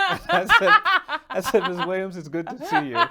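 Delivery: high, loud laughter breaking into words; can't stop laughing while telling it; laughing through it. Real, not acted. Lawyer recalling his reply, laughing out loud.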